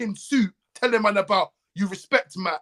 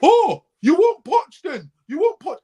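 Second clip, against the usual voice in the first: high, slow, sneering mock voice; mocking rival fans; 9 semitones higher, 6 dB louder, slower than usual. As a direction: mimic the fans who doubt him, drawn out and high. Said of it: "People are like".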